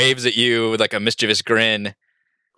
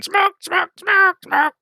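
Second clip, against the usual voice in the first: very high, squeaky repeated cries; squeaky little creature chant; pestering mephit; slower than usual. Acted. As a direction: chirp it over and over like an imp. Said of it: "flying around and going".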